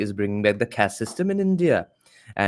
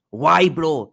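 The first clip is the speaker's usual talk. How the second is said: ordinary delivery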